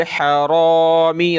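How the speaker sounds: slow chanted हरामी with a thick, rounded "ro" for रा; heavy raa. Shown as a demonstration. Quran teacher modelling recitation, heavy raa in हरामी. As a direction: darken the "r" in हरामी, drawing out "ro". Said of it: "रा को मोटा करें".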